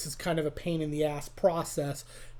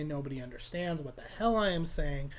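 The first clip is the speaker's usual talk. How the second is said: ordinary talk, nothing marked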